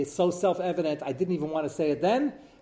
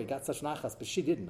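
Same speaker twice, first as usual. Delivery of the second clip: ordinary talk, nothing marked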